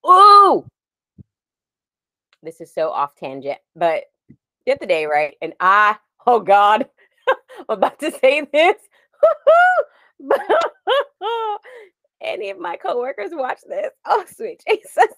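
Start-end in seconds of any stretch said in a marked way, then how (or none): 0.1-0.6 high, loud ooh of alarm; sudden squeal of dismay; mock shock. Shocked at own age. 10.2-11.6 high crying laugh; embarrassed giggle fit; helpless laugh. Real, not acted. Embarrassed about story. 14.1-15.1 oh sweet Jesus, high and laughing; giggling oh sweet Jesus; laughing exclamation. Real, not acted. Embarrassed exclamation.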